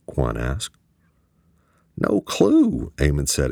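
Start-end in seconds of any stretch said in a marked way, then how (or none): 2.0-2.9 high, keyed-up answer; excited. A narrator's reading. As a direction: toss it out wound up.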